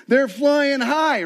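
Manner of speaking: drawn-out high excited lift; slow, high and loud like they're floating; elated. Retold or imitated. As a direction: stretch it out, soaring with joy. Preacher mimics post-camp elation.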